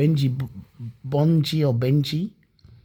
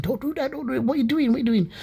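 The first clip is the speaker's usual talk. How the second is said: higher, hushed urgent begging; retold alarmed pleading; 11 semitones higher, faster than usual. Passenger retelling how he begged Andre mid-robbery, higher hushed pleading. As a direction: plead with him to stop, under your breath.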